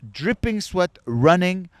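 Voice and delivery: ordinary talk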